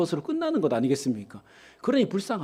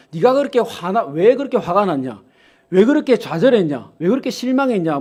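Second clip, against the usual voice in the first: preaching at full force; 3 semitones higher, 7 dB louder than usual. Acted. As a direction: drive the point home to the pews. Preaching builds to a climax.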